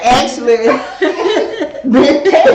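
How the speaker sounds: high, loud laughter through the words; loud laughing. Real, not acted. Mother laughing loudly as she repeats the punchline.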